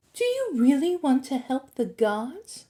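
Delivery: lightly voiced temptress; coaxing Medusa. A narrator's reading. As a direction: coax with a lifted questioning lilt. Medusa coaxing Percy in character.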